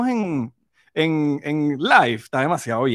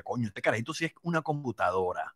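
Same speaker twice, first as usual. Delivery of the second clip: lightly voiced proud father, softer; a hint of a proud dad; imitating proud dad; 5 semitones lower, 12 dB quieter than usual.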